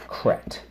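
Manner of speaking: "cret." said alone, unvoiced, as the second syllable; just the tail "cret." on its own. Shown as a demonstration. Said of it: "Second syllable".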